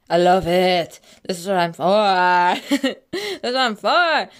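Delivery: loud, exuberant gushing; thrilled delight. Real, not acted. Vlogger loving the spooky dark park, thrilled delight. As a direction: belt out the delight, stretching the ending.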